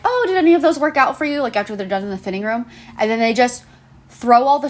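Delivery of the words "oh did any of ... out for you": much higher, quick sugary staff voice; customer service voice. Retold or imitated. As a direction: chirp it at the customer, fake-polite. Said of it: "and we're like".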